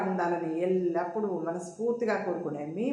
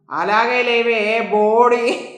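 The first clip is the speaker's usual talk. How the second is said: loud, slow, drawn-out insult; defiant storyteller's comeback, punched louder; acted defiant retort; 4 semitones higher, 13 dB louder, much slower than usual. A narrator's reading. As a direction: throw the insult back, stretching the words. Heroine's parting insult in quarrel.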